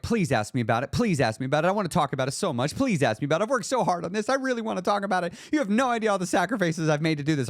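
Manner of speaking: high, fast, pleading inner voice; eager inner begging. Pretend. Inner voice begging friends to ask about the weight loss, eager. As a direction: beg to be asked, bursting with eagerness.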